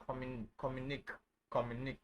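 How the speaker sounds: communic said with a silent ending, stopping on "neek"; without the accent. Shown as a demonstration. Teacher shows the word without its accent, communic ending in "neek". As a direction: end communic on a hard k. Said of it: "If I don't have this there, it is pronounced".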